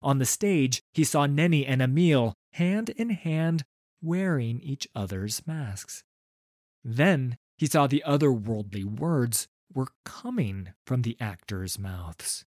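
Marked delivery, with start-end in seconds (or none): none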